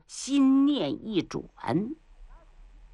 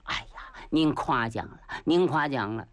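mock modesty; 7 semitones lower than usual. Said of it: "岳霆假门假式的".

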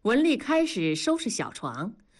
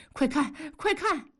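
high, urgent cry to look; agitated calling; 7 semitones higher than usual. A narrator's reading. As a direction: call her over in a fluster. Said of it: "直叫唤".

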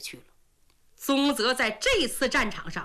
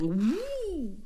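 swoosh imitation. Axes swung down.

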